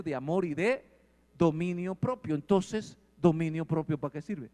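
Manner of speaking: loud, driving sermon voice; forceful preaching. Acted. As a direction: preach it out to the whole church. Preaching loudly to congregation.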